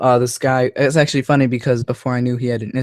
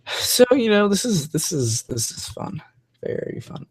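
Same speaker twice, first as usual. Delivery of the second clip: sarcastic, voice dropping away to nearly nothing; 8 semitones higher than usual. Real, not acted. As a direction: feign enjoyment, letting it deflate into a whisper. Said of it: "I'm being sarcastic".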